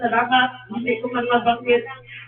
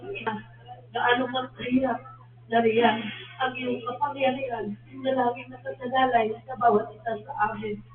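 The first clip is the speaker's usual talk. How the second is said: prayer; 7 dB quieter than usual. Real, not acted. Leads a closing prayer.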